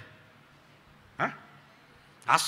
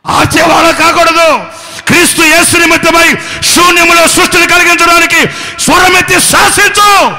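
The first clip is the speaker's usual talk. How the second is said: full-force preaching; 23 dB louder than usual. Acted. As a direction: preach it out with all your might. (పూర్తి శక్తితో ప్రసంగం)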